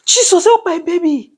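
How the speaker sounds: put-on retelling a panicked prayer while her baby chokes, crying; crying plea. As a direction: beg for the baby through real tears. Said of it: "I was just saying".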